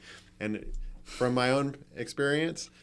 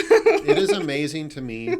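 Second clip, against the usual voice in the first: laughing; 6 semitones higher, 11 dB louder than usual. Real, not acted. Still laughing.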